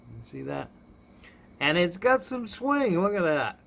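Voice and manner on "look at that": ordinary talk, nothing marked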